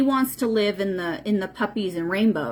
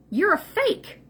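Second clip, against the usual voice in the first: stern; 9 semitones higher than usual. Real, not acted. Stern confrontation of the man.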